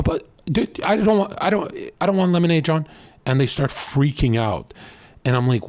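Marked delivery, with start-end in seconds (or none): 0.0-2.9 high, fast, tripping over the words; flustered woman. Retold or imitated. Flustered woman at a party stumbling over her reply. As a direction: blurt it out, caught off guard and flustered. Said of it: "Every single woman goes".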